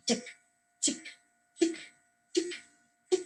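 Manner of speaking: clipped tick, tick, tick of a coaster climbing; ticking sound. Shown as a demonstration. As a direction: click out each tick with a pause between. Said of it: "You use the onomatopoeia".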